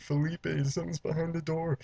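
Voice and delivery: high-pitched pretend whimper; mock crying. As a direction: imitate a scared, tearful girl. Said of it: "her just crying and stuff".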